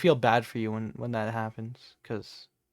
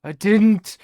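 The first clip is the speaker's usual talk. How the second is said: loud denial; 6 semitones higher, 12 dB louder than usual. Real, not acted. Accused of farting, denies.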